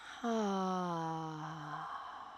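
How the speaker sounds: long open-mouthed sigh out; audible sigh. Shown as a demonstration. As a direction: demonstration sigh. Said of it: "sigh it out".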